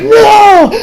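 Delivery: high, loud, anguished mock scream. Retelling the boy waking up that morning, acted screaming. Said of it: "he's completely screaming".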